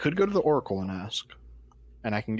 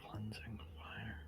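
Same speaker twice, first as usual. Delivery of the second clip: whispering; 16 dB quieter, slower than usual. Real, not acted. Repeats in a whisper.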